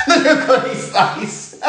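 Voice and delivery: high, slow, laughing through it; cracking up at the memory. Real, not acted. Recalling the guard's face, laughing helplessly.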